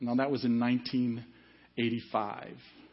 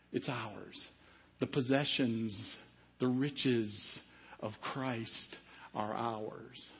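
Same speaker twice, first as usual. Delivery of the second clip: hushed sermon voice; 2 semitones lower, 7 dB quieter, much slower than usual. Real, not acted.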